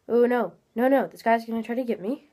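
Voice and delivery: ordinary talk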